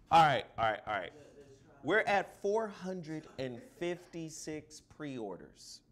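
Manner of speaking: usual speaking voice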